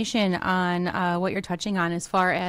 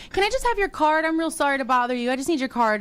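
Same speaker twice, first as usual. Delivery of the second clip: polite stranger; 7 semitones higher than usual. Retold or imitated. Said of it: "And they're like".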